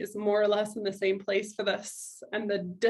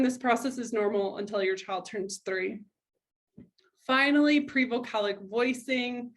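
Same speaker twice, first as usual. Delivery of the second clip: usual speaking voice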